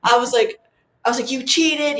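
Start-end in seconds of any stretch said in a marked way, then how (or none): 1.3-2.0 higher-pitched retold accusation; mildly raised, accusing mock yell. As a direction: retell an indignant yell at a sibling, only half-shouted. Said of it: "I was yelling at him".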